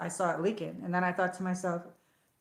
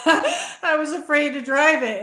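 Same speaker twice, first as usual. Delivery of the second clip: laughing; 7 semitones higher, 7 dB louder, slower than usual. Real, not acted. Laughing about fearing explosion.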